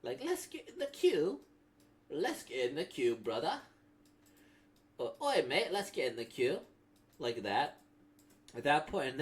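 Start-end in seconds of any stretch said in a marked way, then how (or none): 0.2-3.6 loud, high British English put-on; British accent. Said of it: "such a British English thing to say". 5.2-6.6 high, loud matey British put-on; British accent. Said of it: "such a British English thing to say".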